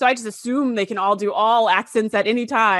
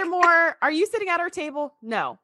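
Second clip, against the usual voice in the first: mock scolding; 6 semitones higher than usual. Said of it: "mock telling off Pulaski".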